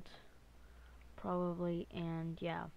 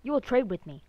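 commanding; 4 semitones higher, 10 dB louder than usual. Pretend. Commanding a villager to trade.

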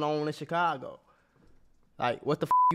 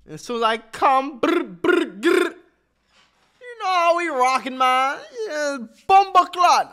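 hype ad-libs; 9 semitones higher, 8 dB louder, much slower than usual. Acted. Hyped gun ad-libs.

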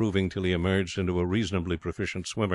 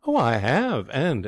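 lightly self-satisfied, higher pitch; pleased with his own scheme; a proud little announcement; modest pride; 6 semitones higher, 4 dB louder, slower than usual. A narrator's reading. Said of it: "added Mister Mifflin with modest pride".

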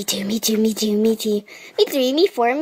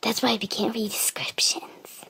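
soft unvoiced whisper; 4 semitones lower, 7 dB quieter than usual. Real, not acted. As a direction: whisper it behind a hand. Whispered aside to friend.

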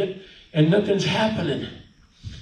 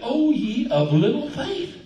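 higher acted reproach; mild scolding; 7 semitones higher than usual. Jesus to Peter after he sunk, mild higher scolding. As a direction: chide him, lifting the pitch. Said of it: "Jesus scolded him".

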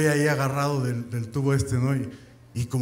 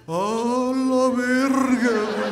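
high, drawn-out ghostly wail; Llorona wail; 8 semitones higher, 3 dB louder, much slower than usual. Retold or imitated. The Llorona spots the huge guy and wails in fright. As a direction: stretch it into a long, high ghost's howl. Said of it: "la Llorona me ve y dice".

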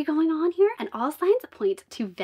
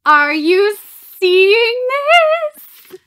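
much higher, louder, rising in awe; excited disbelief; 9 semitones higher, 11 dB louder, much slower than usual. Real, not acted. Showing the viewers a stunning book, excited gush.

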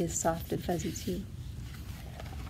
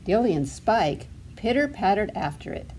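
usual speaking voice

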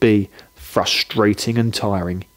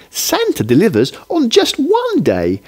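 put-on little boy; 10 semitones higher than usual. Hayden telling Johnny why he is special, high and earnest. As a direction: tell him like an awed small boy. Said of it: "Hayden tells Johnny".

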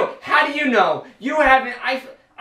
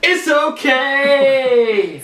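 slow drawn-out teasing; 3 semitones higher, 3 dB louder, much slower than usual. Acted. Consoling the partner who was wrong, slow singsong tease. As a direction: stretch out "It's okay" very slowly, singsong.

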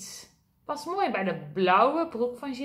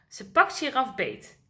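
usual speaking voice

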